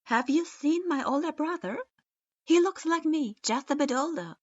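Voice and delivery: high, loud questioning of strangers; eager asking. A narrator's reading. Sasuke asking a civilian about his brother, high and eager. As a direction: pester each passerby.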